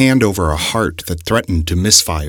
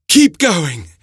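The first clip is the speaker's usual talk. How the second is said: high, pressing urge onward; urging; 11 semitones higher, 4 dB louder than usual. A narrator's reading. The professor urges Montario onward, high and pressing. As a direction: push him onward with urgency.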